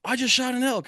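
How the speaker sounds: high, carrying call; shouted news across a ridge. Retold or imitated. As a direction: call it out to someone far off. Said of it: "he's yelling".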